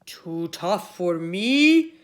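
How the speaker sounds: loud, very slow offended roar; indignant shout. Acted. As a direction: scoff at the insult. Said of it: "shouted Cuchulainn".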